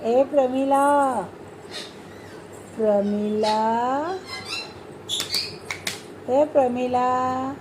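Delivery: slow, drawn-out name call. Real, not acted. Calling the child by name.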